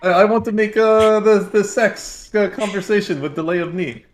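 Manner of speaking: put-on high, broken-English Russian accent; Russian accent with stifled laughter. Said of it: "like, a Russian voice".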